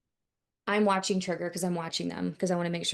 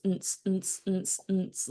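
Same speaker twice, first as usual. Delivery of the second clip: repeated vocal thump of party music; imitated bass beat; slower than usual. Resident complaining about neighbors' music, imitating the bass. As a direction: mouth a steady club-music bass pulse. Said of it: "the bass of their music".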